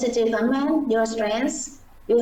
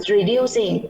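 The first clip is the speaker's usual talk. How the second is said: usual speaking voice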